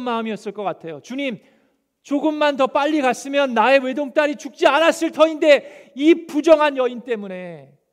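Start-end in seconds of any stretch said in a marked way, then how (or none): 1.0-7.8 loud, high, bitter reproach; resentful anger. Pretend. Imagined father reproaching Jesus for coming late, loud resentful cry. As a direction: blame him for being late. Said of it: "원망하고 화가 났을 것 같아요".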